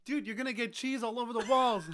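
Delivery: high-pitched, half-laughing scolding; mock exasperated whine. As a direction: whine like a panicking party host. Will scolds wall-climbing kid.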